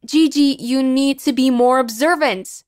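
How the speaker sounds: usual speaking voice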